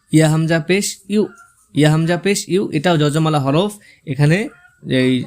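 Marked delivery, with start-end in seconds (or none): none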